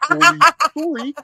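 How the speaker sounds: squeaky, loud Canadian apology; Canadian sorry. Retold or imitated. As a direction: blurt an unstoppable Canadian sorry. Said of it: "Dan Aykroyd's Canadian".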